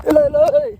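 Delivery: loud, high call across the field. Real, not acted. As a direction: shout to someone far off. Calling out across the farm. (દૂર કોઈને બૂમ પાડવી)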